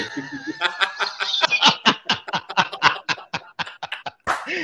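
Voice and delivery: long, high burst of laughter; booming laugh. Real, not acted. Guest answers a pointed remark with long, high laughter. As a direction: laugh hard and keep it rolling.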